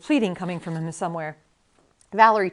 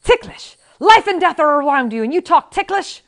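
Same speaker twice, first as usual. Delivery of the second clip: high, loud indignant explosion; angry outburst; 9 semitones higher, 10 dB louder than usual. A narrator's reading. Inigo exploding at Max over tickling, high and loud. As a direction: blow up at the absurd question. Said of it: "Inigo exploded angrily".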